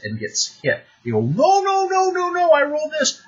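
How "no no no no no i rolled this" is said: high, loud rush of protest; squealed mock objection, pitched way up; protesting player. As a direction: mimic a player frantically objecting. Player protesting a surprise hit.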